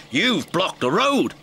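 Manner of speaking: snapping. A narrator's reading. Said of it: "Bertie snapped".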